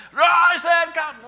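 high, drawn-out shout; Lazarus call. Retold or imitated. Parent calling the kids in a booming shout. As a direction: bellow it like raising the dead. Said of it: "you give them the Lazarus call".

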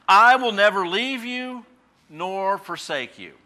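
sermon proclamation; preaching. Acted. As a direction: proclaim the promise slowly, with weight. Quoting God's promise in preaching.

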